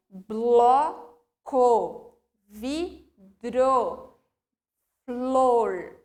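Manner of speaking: "Bloco", "vidro", "flor" with every consonant sounded; careful clusters. Shown as a demonstration. Teacher listing example words with clusters. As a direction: spell out each consonant pair. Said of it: "cada consoante com o seu som".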